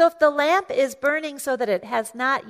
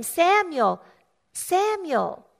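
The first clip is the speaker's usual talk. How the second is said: higher, drawn-out call with a falling tune; calling by name; 5 semitones higher, slower than usual. Acted. God calls Samuel by name, calling out high. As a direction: call out the name, pause, call it once more. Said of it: "calls him by name".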